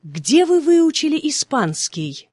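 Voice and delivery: usual speaking voice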